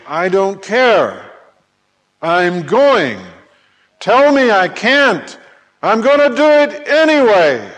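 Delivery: high, loud defiant declaration; defiant resolve. Acted. Individual determined to rush into the kingdom, defiant.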